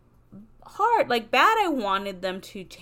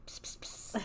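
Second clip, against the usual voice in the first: unvoiced pss sounds, very soft; mock whisper; 12 dB quieter than usual. Shown as a demonstration. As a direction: hiss like a whispery ASMR voice. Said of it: "not a fucking whispery".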